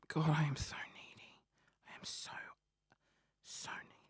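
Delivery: very soft, fading to a whisper; self-reproachful whisper. Real, not acted. Podcaster scolding himself for being needy, whispered. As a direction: whisper it to yourself.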